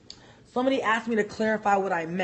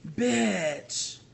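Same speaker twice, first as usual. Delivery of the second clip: ordinary talk, nothing marked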